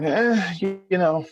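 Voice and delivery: high mock sigh of a tired coworker, shrugging it off; weary brush-off. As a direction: play her dodging the question, pitched high. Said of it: "And she was like".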